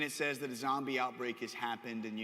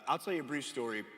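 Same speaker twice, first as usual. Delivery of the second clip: normal talking voice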